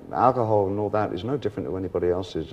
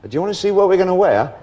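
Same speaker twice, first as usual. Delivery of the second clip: excited; 8 semitones higher, 6 dB louder than usual. Retold or imitated. Voicing himself pitching costumes.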